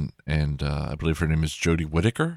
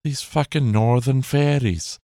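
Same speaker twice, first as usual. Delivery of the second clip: high, hammed-up northern English snarl; putting on a northern England accent, Guy Ritchie style; mock northern English; 8 semitones higher than usual. Impersonating a Guy Ritchie northern England thug, northern English accent. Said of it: "in a Guy Ritchie movie would be like".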